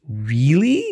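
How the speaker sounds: REALLY drawn out, climbing into a question; stretched REALLY with a big upward swoop. Acted. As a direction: say REALLY as a long, rising query. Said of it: "Maybe not with that tone".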